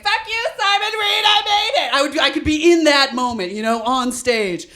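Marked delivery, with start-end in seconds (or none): 0.0-1.9 high, defiant acceptance-speech cry; triumphant Emmy speech. Pretend. Winning an Emmy, yelling at a rival, high and triumphant. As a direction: fling it at the rival like a winner. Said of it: "in the middle of being like".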